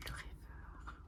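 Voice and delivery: whispered card name; hushed whisper. Real, not acted. Reading a card title to viewers, hushed whisper. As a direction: breathe the name in quiet awe.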